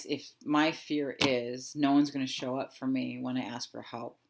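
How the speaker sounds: ordinary talk, nothing marked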